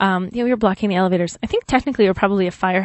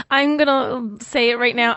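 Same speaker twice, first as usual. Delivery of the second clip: ordinary delivery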